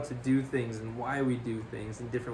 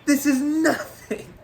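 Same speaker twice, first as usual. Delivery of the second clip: screaming rant, much higher and louder; furious yelling chef; 14 semitones higher, 8 dB louder than usual. Retold or imitated. Ex-Marine chef screaming at his cooks in the chill, furious. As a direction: tear into the new cooks like a drill sergeant. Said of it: "this guy just goes off".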